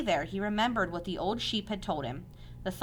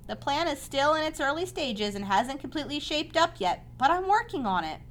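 higher-pitched, untroubled update; light, breezy; 8 semitones higher than usual. A narrator's reading. Spider reporting on an unfinished plan, lightly. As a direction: keep it light and unworried. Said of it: "she said lightly".

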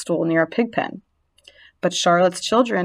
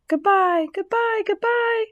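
high, tiny fading goodbyes; faint spider voices; 14 semitones higher than usual. A narrator's reading. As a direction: call out small and far away. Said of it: "came weakly".

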